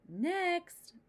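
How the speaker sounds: high, loud call across the room. Acted. Doctor calling out to the waiting room, loud call. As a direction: call the next patient in. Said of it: "called Doctor Grizzly".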